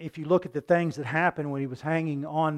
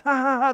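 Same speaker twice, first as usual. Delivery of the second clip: mock crowd laugh; 10 semitones higher, 7 dB louder than usual. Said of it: "the teen movies where everybody laughs".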